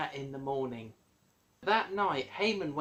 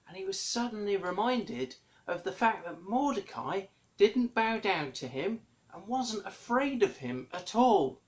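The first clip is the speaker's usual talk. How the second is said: ordinary talk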